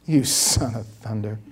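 low, slow mock curse; drawn-out, soft mock insult; mock muttered insult. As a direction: mutter it low like a swear word. Jokes it as an insult.

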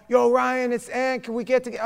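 put-on high, loud New York accent; excited New York buddy voice. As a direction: imitate the Brooklyn friend on a call. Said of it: "he would have the worst New York accent".

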